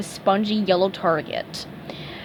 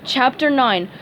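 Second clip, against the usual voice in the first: usual speaking voice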